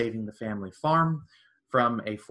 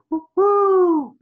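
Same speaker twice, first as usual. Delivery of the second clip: loud, much higher hooting call; calling the horse; 11 dB louder than usual. Retold or imitated. Blind owner calls out to his horse, loud high hoot. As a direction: hoot out to a horse far away. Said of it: "he gives him the call, like".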